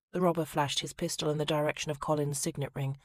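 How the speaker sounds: ordinary delivery, nothing marked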